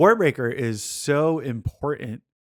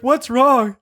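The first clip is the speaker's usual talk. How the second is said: 1 concerned partner; 11 semitones higher, 6 dB louder than usual. Retold or imitated. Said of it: "And she's like".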